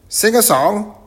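loud, very high professor voice; muddled professor. Acted. Professor mishears the greeting, answers loud and high. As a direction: boom it out high, quite sure of yourself.

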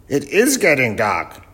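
loud, high professor voice; eccentric professor. Acted. Professor sizes up the young crew, loud and high. Professor mishears shark as dark.

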